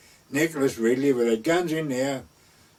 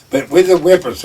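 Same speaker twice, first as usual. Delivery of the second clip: normal talking voice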